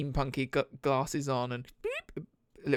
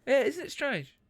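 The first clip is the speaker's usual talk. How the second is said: high-pitched try at an Aussie lilt; "strange" falling off in a mock Australian accent; Australian attempt; 9 semitones higher than usual. Shown as a demonstration. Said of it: "I'm just gonna go up at the end".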